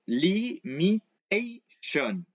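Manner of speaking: limitation very slow, TA stretched and stressed; slow drilled limitation; stress before shon. Shown as a demonstration. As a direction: land hard on the TA of limitation. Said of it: "just before the shon is where you place the accent".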